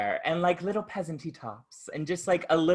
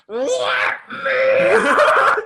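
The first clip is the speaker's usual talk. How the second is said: much higher and louder laughing shriek; mock shriek; 11 dB louder, much slower than usual. Woman prying the bus door, laughing mock shriek.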